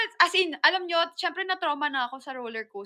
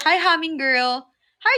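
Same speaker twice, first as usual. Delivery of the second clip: ordinary delivery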